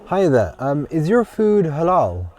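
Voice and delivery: calling out. Real, not acted. Ordering at a counter.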